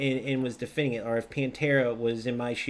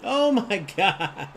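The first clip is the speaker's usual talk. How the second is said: laughing oh my God; 6 semitones higher, 4 dB louder, much slower than usual. Real, not acted. Laughing at a play in game.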